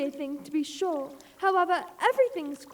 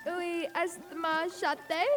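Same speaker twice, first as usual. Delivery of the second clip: halting schoolgirl French; 3 semitones lower, 7 dB quieter, much slower than usual. Acted. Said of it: "First sentence in Alice's French book".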